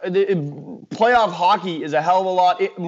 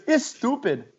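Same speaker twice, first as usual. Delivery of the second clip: put-on higher-pitched, light Indian accent; 6 semitones higher, 4 dB quieter than usual. Retelling a friend's reaction to his first football game, light Indian accent. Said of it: "He looks at me in an Indian accent".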